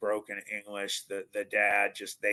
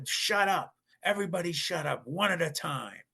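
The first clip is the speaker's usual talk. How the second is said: high, raised-voice order for silence; mock angry dad; 8 semitones higher, 3 dB louder than usual. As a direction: cut everyone off like an exasperated father. Dad silencing the arguing kids.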